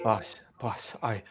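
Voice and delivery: hushed frightened begging; scared quiet pleading. Acted. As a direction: beg the boss under your breath. Henchman begging boss quietly.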